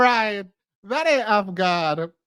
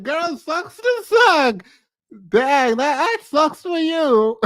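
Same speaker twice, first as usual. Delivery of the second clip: higher, slow, smug brush-off; B Simone mocking the friend, higher and smug; mock dismissive; 6 semitones higher, slower than usual. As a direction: toss it off like it's not your problem. Imitating B Simone's dismissive attitude.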